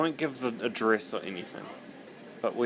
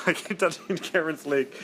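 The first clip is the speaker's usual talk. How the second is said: laughing; 6 semitones higher than usual. Real, not acted.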